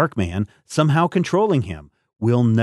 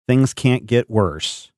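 usual speaking voice

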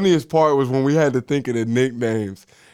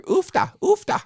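high-pitched mock Minnesotan "oofta"; squeaky-high Minnesotan imitation; mock Minnesotan exclamation. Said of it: "hearing them say this shit back and forth".

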